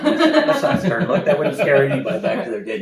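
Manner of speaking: high, loud laughing protest; laughing teasing. Real, not acted. Teller to listener after a weak stern look, laughing. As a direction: laugh while scoffing at the attempt. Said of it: "Oh, come on".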